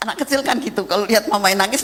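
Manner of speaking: usual speaking voice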